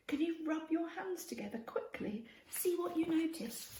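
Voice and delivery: ordinary delivery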